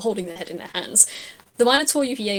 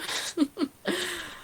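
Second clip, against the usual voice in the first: laugh; 9 semitones higher, 6 dB quieter than usual. Acted. [name] laughs at the phrase.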